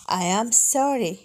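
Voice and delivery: ordinary talk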